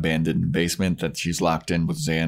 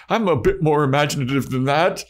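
Spike voice; 8 semitones higher, 3 dB louder than usual. Retold or imitated.

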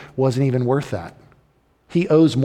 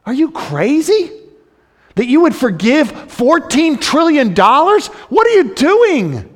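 high, loud disbelief; can't believe the king forgave it; incredulous crowd; 11 semitones higher, 4 dB louder than usual. Retold or imitated. Crowd shouting at the king in loud disbelief. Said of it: "They now think the king is even more foolish".

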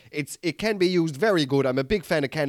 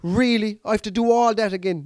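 despairing whine; 7 semitones higher than usual. Retold or imitated. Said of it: "I was like".

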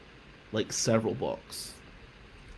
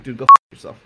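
much louder, spat at the rival; defiant shout; 20 dB louder than usual. Retold or imitated. Student shouting back at teammate who told him to leave, defiant yell. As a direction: blast it in his face.

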